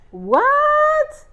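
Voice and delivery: loud, high, drawn-out squeal; exaggerated squeal of disbelief; flabbergasted. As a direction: shoot "what" way up in shock. Final shocked reaction.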